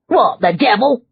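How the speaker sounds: startled yelp. A narrator's reading. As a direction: yelp it in sudden shock. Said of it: "the coachman yelped".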